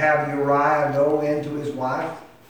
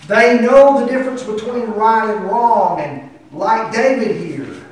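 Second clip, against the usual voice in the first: raised, high preacher's delivery; forceful preaching; 8 semitones higher, 10 dB louder than usual. Real, not acted. Preacher telling congregation of Uriah's betrayal, forceful and raised. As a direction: drive the story home with preaching force.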